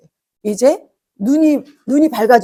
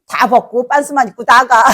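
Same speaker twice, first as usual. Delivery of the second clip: louder, laugh breaking in at the end; amused retelling; 5 dB louder than usual. Retold or imitated. Foolish child stripping to leave home, laughing retelling. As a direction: tell it with laughter spilling out.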